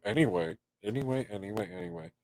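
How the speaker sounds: fading to nothing, repeated. Real, not acted. Trailing off, repeating anyway.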